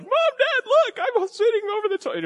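put-on very high falsetto child showing off; mock little-boy voice. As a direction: squeal proudly to parents like a toddler.

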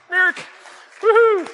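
high, loud whoop; cheer. Real, not acted. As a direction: whoop to get the crowd clapping. Cheering Mark up front.

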